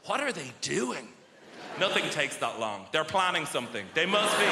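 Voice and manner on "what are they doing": ordinary talk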